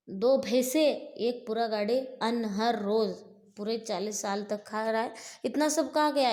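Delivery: mock the monster's gluttony with a furious roar; furious taunt. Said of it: "बोल के भीम उसको पूछता है". (भीम का राक्षस पर गुस्से से चिल्लाता ताना)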